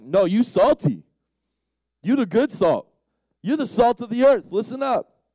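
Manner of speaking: very loud, rising proclamation; emphatic affirmation. Acted. Preacher voices Jesus praising his followers, emphatic and loud. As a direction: insist on it as high praise, booming. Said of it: "But Jesus being like".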